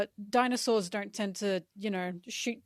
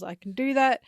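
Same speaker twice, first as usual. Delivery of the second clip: higher, louder eager reply; mock yell; 4 semitones higher, 6 dB louder than usual. Zeus answering Nami's command, mock yell. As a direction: call it back in a raised voice. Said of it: "And Zeus yells".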